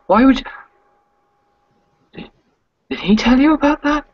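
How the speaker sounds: high, loud, rattled demands; agitated confrontation. Acted. Sasha cornered against a dresser, loud and rattled. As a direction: corner her about who told.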